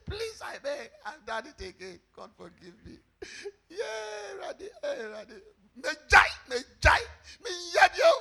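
mock tearful, high-pitched begging; hammed-up remorseful wail; wailing penitent. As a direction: act out a sinner crying out to God. Acts out a remorseful sinner praying.

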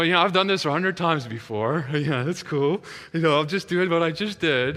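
mock-confident, a bit high and loud; put-on casual bravado; acting cool. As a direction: play at being an old hand at this. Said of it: "I'm acting all cool and all".

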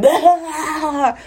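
high-pitched burst of demonstrated laughter; hammed-up high cackling laugh; imitated big laugh. Shown as a demonstration. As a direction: act out an uncontrollable laughing fit. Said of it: "that type of laugh".

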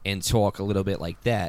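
tight-mouthed, loud Delco accent. Shown as a demonstration. Guest contrasting it with Philly, Delco accent. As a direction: talk small-mouthed, thick Delco. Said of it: "then that's more the Delco accent".

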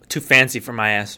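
low drawled country voice; low southern drawl. Pretend. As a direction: grumble like a plain country guy. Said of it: "fucking voice".

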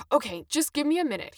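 cornered clerk giving in; stammering. Acted. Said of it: "he stammered".

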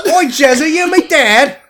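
very high, loud British squawk; hammed-up shrieking British voice; mock British accent. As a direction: holler it as a caricature Brit. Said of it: "put on a British accent".

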